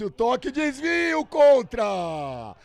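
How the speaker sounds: long stretched goal shout; explosive goal commentary. Real, not acted. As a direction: hold the goal call out loud. Own-goal call, stretched. (grito de gol arrastado)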